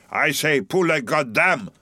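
slow, high, put-on voice; harpooner's cry. A narrator's reading. Tashtego answers Stubb's challenge, emphatic cry. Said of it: "cried the Indian".

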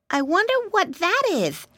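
high curious piglet; little pig voice. Acted. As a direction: wonder aloud like a piglet. Pig character wonders.

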